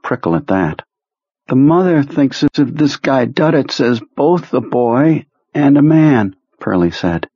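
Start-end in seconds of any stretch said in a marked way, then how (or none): 1.5-6.4 high, slow, drained voice of a sick man; sick psychic Perlmutter. Acted. Ill telepath reporting to his boss, high and drained. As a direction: sound weak and washed out.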